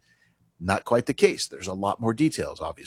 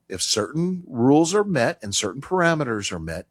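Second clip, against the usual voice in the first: ordinary delivery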